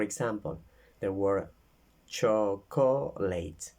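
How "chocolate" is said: chocolate said slowly, first syllable choc stressed. Shown as a demonstration. Teacher shows the class chocolate, slow with stress on choc. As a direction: draw out chocolate with the weight on choc. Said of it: "In the first syllable, I can make a big square".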